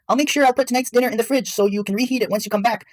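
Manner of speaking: very high-pitched motherly voice; voiced mother. A narrator's reading. Mother promising dinner in the fridge, very high voice. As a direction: pitch it way up as the mom.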